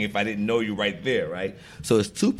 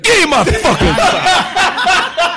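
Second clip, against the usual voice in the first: high, loud, drawn-out outburst; exasperated mock yell; 9 dB louder, much slower than usual. Actor to mistaken salesman at register, exasperated yell. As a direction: explode in comic outrage, laughter close behind.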